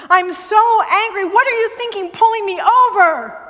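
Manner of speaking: exasperated retold rant; retold anger. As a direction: vent at the officer, half comic. Retold rant at police officer.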